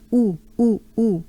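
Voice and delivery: Oo with lips tightly rounded and closed; a tight, closed French ou. Shown as a demonstration. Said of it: "a pretty closed up oo".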